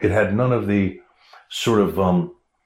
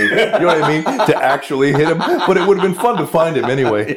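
laughing it off, a bit louder and higher; amused chuckle at the absurd thought; laughing at himself while telling it; 7 semitones higher, 4 dB louder than usual. Real, not acted. Laughing at absurd idea of hitting.

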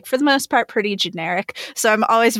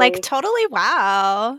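put-on Valley Girl; slower than usual. Shown as a demonstration. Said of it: "the Valley Girl".

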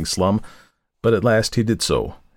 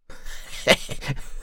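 put-on breathy old woman's chuckle; a sly wheezy chuckle; 5 dB quieter than usual. A narrator's reading. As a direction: cackle of a crafty old woman. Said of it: "gave a chuckle".

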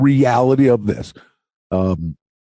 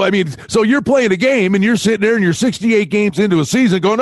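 ordinary delivery

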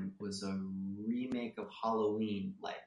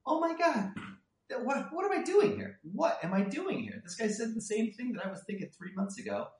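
much higher, panicked inner voice; 12 semitones higher, 3 dB louder than usual. Retold or imitated. Writer panicking inside mid-pitch, in a high squeaky voice. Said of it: "And it was just like".

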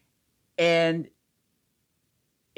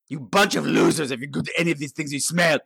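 gargoyle voice. Acted. Gargoyle insulting viewers.

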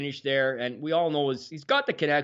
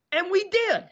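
much higher and louder triumphant payoff; 4 dB louder than usual. Real, not acted. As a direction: land the comeback with a burst of pride. Canada's comeback payoff.

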